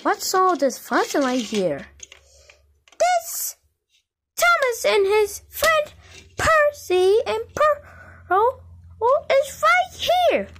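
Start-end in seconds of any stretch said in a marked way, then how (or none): 3.0-10.5 squeaky, tearful high voice; high crying voice. Pretend. Toy pointing out the friends, high tearful voice. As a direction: pitch up as if whimpering.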